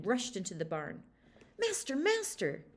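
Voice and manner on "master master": urgent alarm shout. Acted. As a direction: burst in crying out for the master. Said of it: "he shouted".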